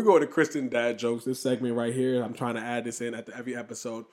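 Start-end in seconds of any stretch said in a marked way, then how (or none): none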